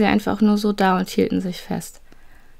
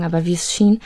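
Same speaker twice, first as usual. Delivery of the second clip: ordinary delivery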